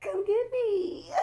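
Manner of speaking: squealing high and laughing, sighing over him; giddy swooning. Real, not acted. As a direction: lovestruck cackling, calling him to come get her. Swooning over her destined man.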